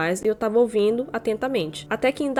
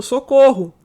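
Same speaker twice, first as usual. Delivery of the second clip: panicked shout; 4 semitones higher, 10 dB louder, slower than usual. Real, not acted. Loose fang, mock panic cry.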